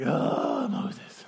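long breathy groan, name trailing off; acted weary exasperation, mostly breath; begrudging sigh. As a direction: sigh it out like a reluctant God. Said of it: "begrudgingly was like".

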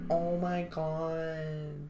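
put-on slow gasping high girl voice; drawn-out gushing gasp. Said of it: "she's like".

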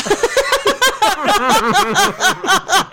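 high burst of laughter. Real, not acted. Laughing at awkward chat.

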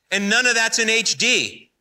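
ordinary delivery

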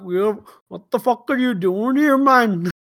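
put-on the relatives bark it in a jokey Irish-or-Brampton accent; mock Irish accent. Irish relatives demand why the American is here, in a wobbly mock Irish accent. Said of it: "was that Irish or Brampton?".

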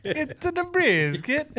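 put-on very high, slow Italian accent; exaggerated pretend Italian accent; mock Italian accent. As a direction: stretch it out in a hammy Italian accent. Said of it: "Did I sound Italian?".

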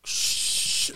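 long hushing chalk swish; chalk sound. Shown as a demonstration. Host acting out Mary Poppins, imitated chalk sound. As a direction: imitate chalk with a drawn-out shh. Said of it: "This is the chalk sound".

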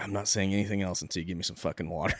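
low, muttered refusal until he gets water; low stubborn demand. Retold or imitated. Thirsty captive refusing to talk.

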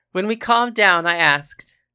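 ordinary delivery

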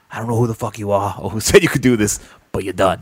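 lightly voiced gruff clown boss; a hint of a rough wrestling boss firing someone. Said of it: "He goes".

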